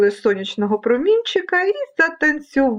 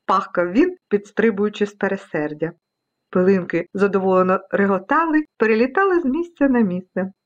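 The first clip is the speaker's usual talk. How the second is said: plain reading voice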